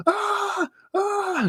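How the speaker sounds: very high, piercing pretend shriek; mock scream. Girl on a bicycle struck by a magpie, piercing mock scream. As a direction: shriek as if a bird just hit you. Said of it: "screaming like a mentalist".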